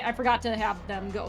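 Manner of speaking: usual speaking voice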